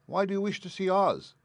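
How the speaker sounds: high, curious tin voice; curious Tin Man. A narrator's reading. Tin Man asking Dorothy about Oz, high and curious. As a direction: ask it with rising interest.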